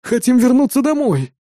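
high-pitched anxious plea; anxious babbling. A narrator's reading. Engineer begging to go home, anxious babbling. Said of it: "начал тараторить инженер".

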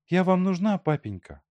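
a downcast question that sinks away at the end; fallen voice. A narrator's reading. Daughter asks her father if he needs her, dejected and sinking. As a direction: ask with a dropping, dejected voice. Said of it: "спросила она упавшим голосом".

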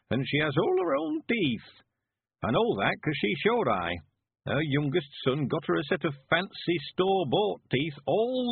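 put-on high, swooping rustic old-timer; high old man's dialect voice; old country man, fondly admiring. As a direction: sing a sweetheart's praises as an old villager. Narrator plays old Mister Weevil.

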